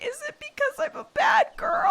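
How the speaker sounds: high, wailing mock-sob, half laughing; imitating a sobbing child. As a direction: play the heartbroken little girl, hammed-up. Kid crying, voiced by mother.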